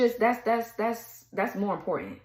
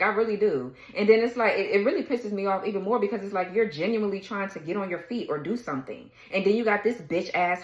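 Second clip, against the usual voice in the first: ordinary talk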